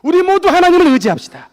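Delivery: very loud, high rallying cry; rally everyone at full preaching force; shouted exhortation. Real, not acted. Preacher urges all to trust God, shouted exhortation.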